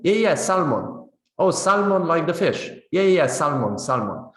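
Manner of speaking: salmon with the l sounded, eager agreement; non-standard pronunciation. Acted. Two friends finally understanding each other, non-standard salmon with an l. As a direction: confirm it, pronouncing the l in "salmon". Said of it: "they kind of adapt".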